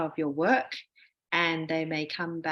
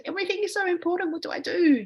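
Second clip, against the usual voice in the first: high, loud panicked cry of an anxious student; mock panic; 12 semitones higher, 4 dB louder, faster than usual. Researcher imitating anxious students overwhelmed by reviews, high loud mock panic. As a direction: blurt it like a student overwhelmed by every comment.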